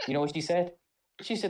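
ordinary talk

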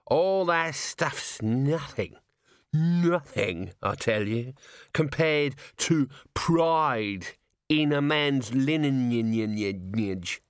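put-on slow, slurred drunken ranting; drunk Nobby. A narrator's reading. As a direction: drag every word out like a swaying drunk. Swaying like an inverted pendulum.

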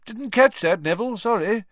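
high, loud and bright teacher; cheerful. A narrator's reading. Teacher asking nervous Neville to repeat himself, cheerfully. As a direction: cheerily ask him to speak up. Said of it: "said Professor Lupin cheerfully".

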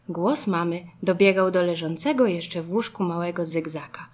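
ordinary delivery

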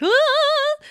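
very high, loud startled shriek; a sharp snake-scare yelp; mock scream. Legs flailing at a snake, loud high shriek. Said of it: "The screaming".

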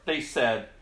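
high, loud, acted gospel reading; dramatic scripture reading. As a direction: cry out the story like the blind men.

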